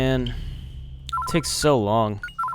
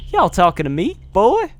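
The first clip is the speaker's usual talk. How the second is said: put-on louder Southern challenge, jumping high on "boy?"; Southern accent; 7 semitones higher, 6 dB louder than usual. Milda squaring up to Rap in a loud Southern accent. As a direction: bristle at a rude stranger in a Southern accent. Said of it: "southern accent".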